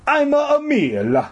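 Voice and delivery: hammed-up Italian janitor; bad Italian accent. A narrator's reading. Strange janitor introduces himself to the girl, bad Italian accent. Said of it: "bad impression of an Italian".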